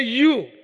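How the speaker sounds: You. said alone, pressed and held; emphasis on You. Shown as a demonstration. Preacher stressing You. to the congregation, emphatic. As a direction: lean hard on the single word You. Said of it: "That is emphasis".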